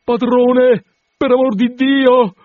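high, loud, drawn-out shouting; panicked cry for help. A narrator's reading. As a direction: yell for the landlord as if for your life.

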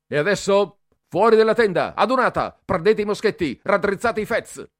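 high, loud rapid commands; drill-sergeant roll of orders; barked orders. A narrator's reading. Cadet barking orders. (Bertoli dà ordini a raffica ai balilla, urlando)

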